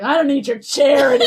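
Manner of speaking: proud, hammed-up rejection of a favour; mock-mad. Teen refusing crush's ticket offer, mock-mad. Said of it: "And I was so mad".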